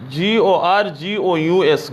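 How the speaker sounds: ordinary talk, nothing marked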